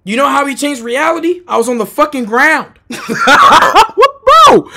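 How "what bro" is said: high, loud cackle into words; laughing in disbelief. Real, not acted. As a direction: crack up at the absurdity. Laughs at his own punchline.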